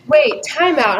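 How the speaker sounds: sudden high alarm. Retold or imitated. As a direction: stop everything, startled. Alarm at outdoor shoot.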